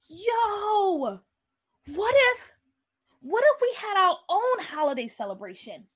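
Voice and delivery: high, loud burst of a sudden idea; excited call that settles as it goes on. A narrator's reading. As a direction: blurt out a brainwave to friends.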